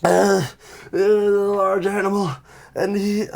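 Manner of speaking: high, halting wounded voice; pained, breathless. Acted. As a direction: gasp out the words in pain. Wounded character gasping out story.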